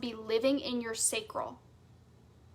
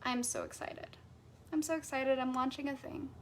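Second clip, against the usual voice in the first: much softer, going through the motions; flat fake excitement; 10 dB quieter than usual. Shown as a demonstration. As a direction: say it like you don't mean it.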